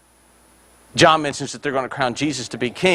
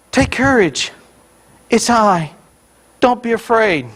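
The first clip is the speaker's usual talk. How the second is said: mild, measured reading voice, slightly raised; gentle misreading; 6 semitones higher than usual. Shown as a demonstration. Preacher showing congregation the usual reading, mild and reassuring. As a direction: read it as a calm reassurance, words set apart. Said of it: "we read it this way".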